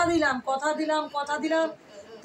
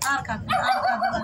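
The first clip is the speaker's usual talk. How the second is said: soothing a crier; 10 semitones higher, faster than usual. Real, not acted. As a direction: coax her tears away gently. Consoling a crying young woman.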